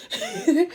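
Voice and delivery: a sudden high burst of laughter. Real, not acted. Vlogger cracking up while retelling the prayer scene, laughing. Narrator laughs at own story.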